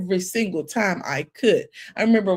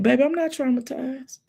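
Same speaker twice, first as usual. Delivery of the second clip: defiant denial; 6 semitones higher than usual. Retold or imitated. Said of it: "And I said".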